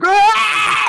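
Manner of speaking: high, loud scream; piercing scream, far above speaking pitch. Acted. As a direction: let out a shrieking "ah" like panicked monsters. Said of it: "And they're screaming".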